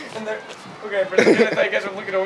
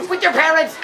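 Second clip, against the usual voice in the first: high-pitched imitation of a scolding; mock stern teacher; 7 semitones higher, 4 dB louder than usual. Teacher sentences pupils to the principal's office, mock stern. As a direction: repeat the teacher's pompous verdict. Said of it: "like, said that with his eye closed".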